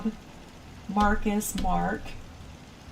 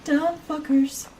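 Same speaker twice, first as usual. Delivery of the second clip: mock jingle; 7 semitones higher, 5 dB louder, slower than usual. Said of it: "you can use anything with that".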